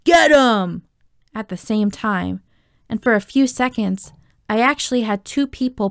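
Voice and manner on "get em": loud, high attack cry; raised, forceful scream; screamed command. A narrator's reading. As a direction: yell it like setting the gang on someone. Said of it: "someone else screamed".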